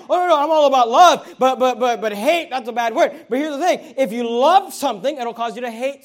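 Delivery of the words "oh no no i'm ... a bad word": mock objection. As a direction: protest loudly, mimicking people who reject the word. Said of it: "people have this idea like".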